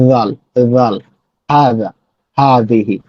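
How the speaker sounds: জাল and হাযা with the tongue between the teeth; voiced th sound in হাযিহি, tongue on upper teeth; tongue-tip dhal. Shown as a demonstration. Teacher demoing the Arabic letter dhal carefully. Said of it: "এই জায়গাটা থেকে উচ্চারণ হবে".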